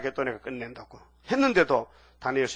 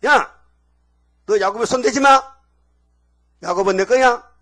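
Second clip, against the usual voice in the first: much higher, much louder furious shout; God shouting; 8 semitones higher, 11 dB louder than usual. Acted. God yelling at Laban to keep his hands off Jacob, full shout. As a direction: roar it as God scolding Laban.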